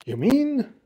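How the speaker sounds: high, astonished start; gasped amazement. A narrator's reading. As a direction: gasp it in wonder. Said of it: "Horton gasped".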